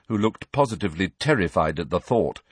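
ordinary delivery, nothing marked

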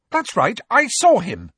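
high, loud and firm; fierce. Acted. Cho facing down Filch, fierce and loud. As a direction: say it fiercely, not backing down. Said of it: "she said fiercely".

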